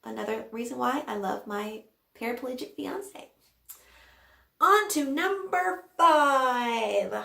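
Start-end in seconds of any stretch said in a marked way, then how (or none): 4.6-7.1 loud, slow, drawn-out announcement; announcer call. Real, not acted. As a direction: announce it like a show host. Announcing next item.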